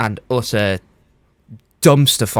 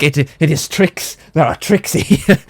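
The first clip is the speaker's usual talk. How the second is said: put-on slow, higher Belgian-accented detective; a lightly done Poirot; Poirot impression; 5 semitones higher, slower than usual. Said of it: "He's like".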